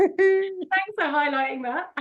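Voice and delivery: slow, high turkey-like warble; gobbling turkey voice. Acted. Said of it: "It's my turkey impression".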